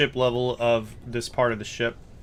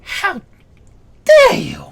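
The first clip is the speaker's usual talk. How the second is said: very high, loud indignant outburst; scoffing; 13 dB louder, slower than usual. Acted. Gnome cook insulted over dropped meat, scoffing. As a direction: scoff in outrage, word by word. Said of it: "he just sort of scoffs".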